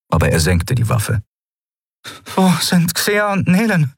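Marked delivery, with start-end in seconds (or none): 2.3-3.9 high-pitched anxious question; anxious heroine. A narrator's reading. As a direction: ask urgently in a high voice. Heroine voiced in tense dialogue. (hohe, besorgte Frauenstimme)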